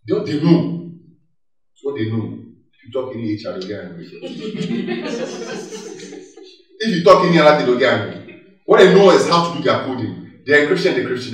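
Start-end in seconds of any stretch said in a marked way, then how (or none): 4.1-6.4 high airy laugh; amused breathy laugh. Real, not acted. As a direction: laugh at the thought. Laughs about angry techies.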